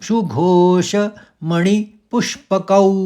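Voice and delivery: सुघोष, मणि, पुष्पकौ slow, in three parts; three word groups. Shown as a demonstration. Teacher recites the fourth quarter in three parts. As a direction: bind the line into three words. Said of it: "मणि, मणि नहीं बोलना है".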